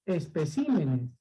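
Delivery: especímenes stressed on CI, es-pe-CI-me-nes. Shown as a demonstration. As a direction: say especímenes with the force on CI. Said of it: "cuando pasa al plural se dice".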